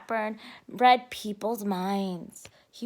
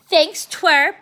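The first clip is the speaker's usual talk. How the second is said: gruff bully; 4 semitones higher, 9 dB louder than usual. Acted. Binky gruff thanks.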